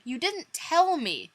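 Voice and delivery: high hurt accusation; upset. Acted. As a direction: demand it, hurt.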